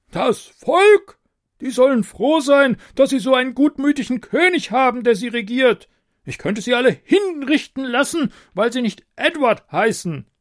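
very high, loud scornful outburst; roaring tyrant king. A narrator's reading. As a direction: scoff like a pompous king. King voiced by narrator.

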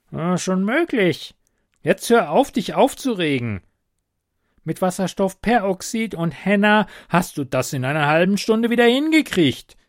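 high kobold voice, waving away worry; high-pitched, breezy kobold reassurance; cheeky kobold, dismissive. Acted. (hoher Kobold, abwiegelnd)

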